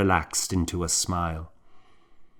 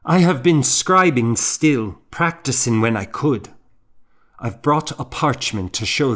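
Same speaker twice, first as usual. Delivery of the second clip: earnest, a bit higher and louder at the start; eager plea; 5 semitones higher, 4 dB louder than usual. Acted. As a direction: press your case eagerly. Young monk pleads his case.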